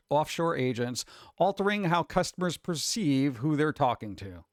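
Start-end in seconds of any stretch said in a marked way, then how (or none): none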